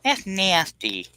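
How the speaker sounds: mock disgusted drawl; drawn-out disgust.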